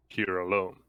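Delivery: ordinary talk, nothing marked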